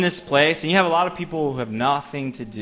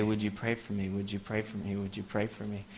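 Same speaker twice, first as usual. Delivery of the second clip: quiet pleading; 4 semitones lower, 12 dB quieter than usual. Retold or imitated. Crowd quietly asking for prayer.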